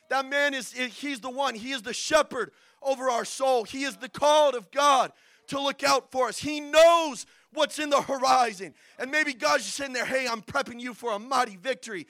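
loud, insistent pulpit exhortation; forceful sermon building to a shout; preaching at full force. Real, not acted. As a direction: urge the congregation, punching key words.

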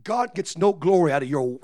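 normal talking voice

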